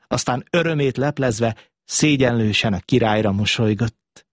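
ordinary delivery

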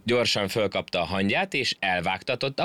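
usual speaking voice

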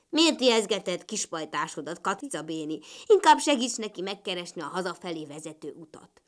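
put-on low frog-child character voice; young frog. A narrator's reading. Frog child chiding ladybird Béni, low creature voice. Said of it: "megszólalt alattuk a kis tó partján".

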